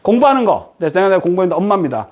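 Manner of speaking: ordinary talk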